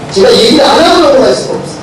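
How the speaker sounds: ordinary talk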